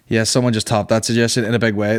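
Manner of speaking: usual speaking voice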